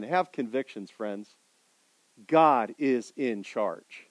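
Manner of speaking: calm them down gently; soft appeal. Real, not acted. Quiet closing appeal.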